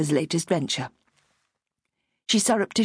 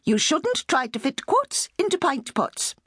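headmistressy; 9 semitones higher than usual. A narrator's reading. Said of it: "the headmistressy voice of conscience".

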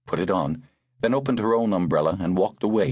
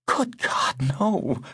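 agonized protest; 9 semitones higher, much slower than usual. A narrator's reading. Girl refusing to go to the police, agonized. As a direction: protest in agony, pitch leaping up. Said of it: "a kind of agony there".

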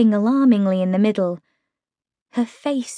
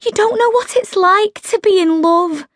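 higher, hammed-up heartache; dramatic lovelorn Meg; 11 semitones higher than usual. A narrator's reading. Meg clutching her forehead at Holly, theatrical. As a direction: play Meg's melodrama to the hilt. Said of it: "clasped her forehead dramatically".

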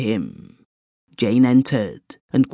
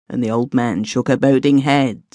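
ordinary delivery, nothing marked

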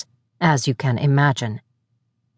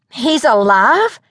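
high, slow astonished question; stunned surprise; 7 semitones higher, slower than usual. A narrator's reading. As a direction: let the shock land slowly. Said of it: "Farron's eyes grew round".

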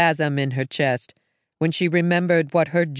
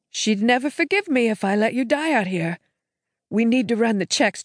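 a hint of a higher, urging sister voice; lightly voiced sister; 6 semitones higher than usual. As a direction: gently push him to act, slightly higher. Sister pleads with Jake.

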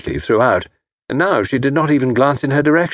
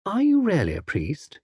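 put-on a soft, higher, wondering young woman's voice; naive young actress; 8 semitones higher, 5 dB quieter than usual. A narrator's reading. Actress asking Tommy if he is a priest, innocent. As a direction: ask it softly, innocently puzzled.

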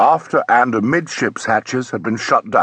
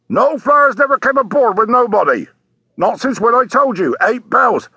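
high-pitched, emphatic sailor voice; ship's sailor; 11 semitones higher, 3 dB louder than usual. Acted. Sailor answering a policeman from the deck, emphatic. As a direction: call down firmly from up at the rail.

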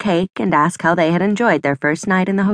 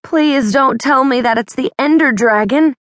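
pleading; 7 semitones higher, 3 dB louder than usual. A narrator's reading. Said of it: "Violet pleaded".

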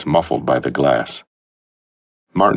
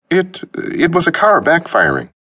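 put-on nervous young man; 3 dB louder than usual. Martin nervously makes up excuse.

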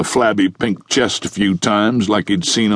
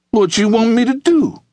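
much higher-pitched indignant retort; snapping back; 13 semitones higher than usual. A narrator's reading. Chocolate to Taji after he yells at her, snapping back. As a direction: throw the question back, voice pitched way up. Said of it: "she snapped back".

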